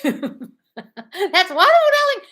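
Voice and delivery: laughing into a high, loud squeal; gushing delight. Real, not acted. Host cheering a viewer's good news, gushing. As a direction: draw it out, soaring up with delight.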